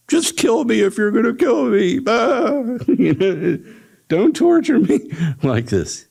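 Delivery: high, frantic begging with a sob in it; terrified pleading. Acted. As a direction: beg to be killed, near tears.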